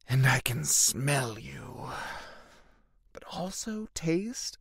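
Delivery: slow, soft breathy whisper; brooding hero savouring the night air, slow whisper; dramatic whisper. Acted.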